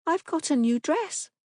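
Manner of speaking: put-on high, small child voice; a timid little girl. A narrator's reading. Daughter showing Papa her dress, small voice. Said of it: "she said in a small voice".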